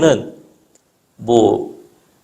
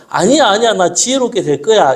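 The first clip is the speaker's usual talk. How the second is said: put-on higher, eager child voice; child's protest; 6 semitones higher than usual. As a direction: protest brightly like a little girl. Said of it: "이렇게 하는 겁니다".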